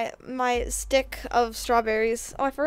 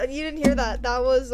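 normal talking voice

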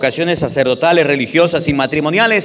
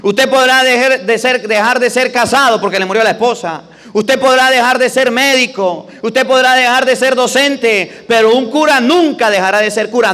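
raised, high, ringing preacher voice; impassioned preaching; 7 semitones higher than usual. Acted. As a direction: preach it out to the whole church. Priest preaching at full force.

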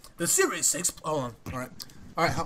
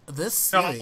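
flamboyant voice. Pretend. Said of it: "flamboyant voice".

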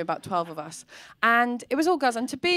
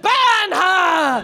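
louder, higher zealot cry; mob call; 5 semitones higher, 6 dB louder than usual. Retold or imitated. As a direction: call for the burning. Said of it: "they were like".

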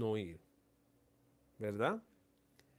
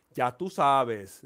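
catchphrase imitation; 9 dB louder, much slower than usual. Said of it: "como dice".

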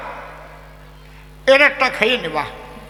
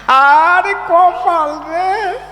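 despairing wail; 8 semitones higher, 5 dB louder, much slower than usual. Real, not acted. Teller despairs at the joke.

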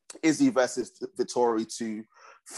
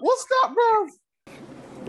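high-pitched, bewildered questioning; baffled disbelief; 4 dB louder, much slower than usual. Real, not acted. Co-host reacting to an unheard-of champion title, baffled. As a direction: squeak it out in total bafflement.

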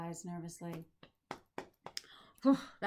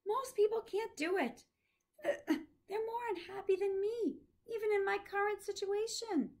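put-on higher, louder old professor; Maury voice; 12 semitones higher, 4 dB louder than usual. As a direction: speak as the dying old professor. Teacher voicing Maury's words.

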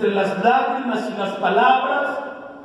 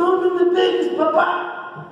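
put-on higher, small pleading child voice; frightened son pleading; 6 semitones higher than usual. Son begging his father not to hit him, scared. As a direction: shrink and beg not to be hit. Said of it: "hasta se hizo chiquito".

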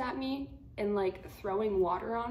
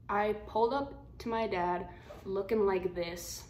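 ordinary talk, nothing marked

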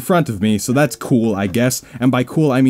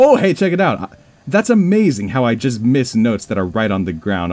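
usual speaking voice

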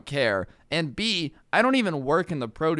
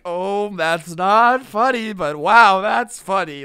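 uncomfortable laugh; 4 semitones higher, 8 dB louder, slower than usual. Retold or imitated. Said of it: "I laugh when I'm uncomfortable".

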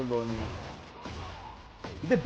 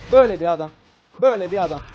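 louder, punchy repeat of Böyle bir adam; emphatic repeat; 8 dB louder, faster than usual. Real, not acted. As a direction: hammer the point home.

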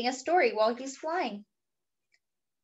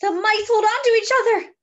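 higher, louder, fast excited narration; excited build; 11 semitones higher, 8 dB louder, faster than usual. A narrator's reading. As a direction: rush it with suspense. Tense takeoff moment narrated.

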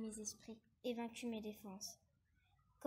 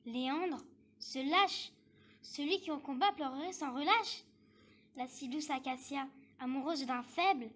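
scornful outburst; 6 dB louder than usual. Acted. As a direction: spit out the rival's cowardice, voice rising. Jealous minister denounces his rival. (mépris rageur, voix qui monte)

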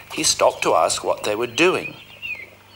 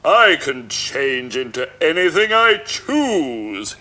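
slow, louder scornful ogre voice; sneering ogre; 5 semitones higher, 4 dB louder than usual. Acted. Ogre scoffing at Puss, slow sneer. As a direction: sneer down at the cat. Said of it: "he sneered".

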